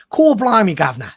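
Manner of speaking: loud, high cockney cry, dropping on governor; London accent. Shown as a demonstration. Host sets the test line for the others, mock London accent. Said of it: "try and do a London accent".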